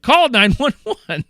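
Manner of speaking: urgent call for help. Retold or imitated. Friend voiced in the story.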